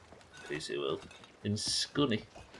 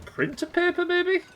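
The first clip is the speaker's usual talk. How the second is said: squeaky joke. Pretend. Jokey high-pitched answer.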